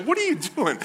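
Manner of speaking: high-pitched, exasperated dad squeal; mock alarm. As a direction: act out a dad caught in a dirty play fight. Dad retelling being eye-gouged.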